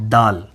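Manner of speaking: دال ending on a clear l; letter demo. Shown as a demonstration. Teacher models دال ending on l. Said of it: "آخر میں لام کی آواز آتی ہے".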